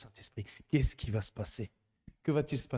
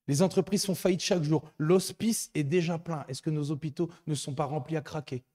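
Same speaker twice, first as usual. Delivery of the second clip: raised, urgent preaching voice; preaching at full force; 4 semitones higher, 3 dB louder than usual. Acted. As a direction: exhort the congregation with fervour. Preacher exhorting congregation.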